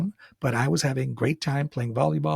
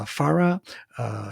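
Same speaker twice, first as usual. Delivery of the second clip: plain reading voice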